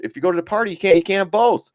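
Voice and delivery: acted parent explaining the either-or; reasoning dad. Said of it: "I said".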